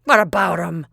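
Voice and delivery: loud, gruff complaint; cranky old grandfather complaining about the boy; grumpy old man. Acted. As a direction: grumble loudly like a cranky colonel.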